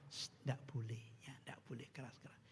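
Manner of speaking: whispered, low and soft; mother's whispered hush. Retold or imitated. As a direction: whisper a hush to calm the room. (ibu berbisik menenangkan)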